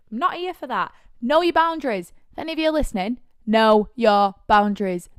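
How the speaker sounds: ordinary delivery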